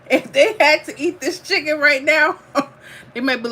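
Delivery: talking through laughter; giggling while speaking; laughing. Real, not acted. Laughing at her chicken.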